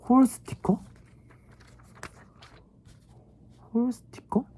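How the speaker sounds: high squeaky puzzled repeats; confused squeak. Real, not acted. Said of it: "뭔 소린지 모르겠는데?". (높고 어리둥절한 목소리)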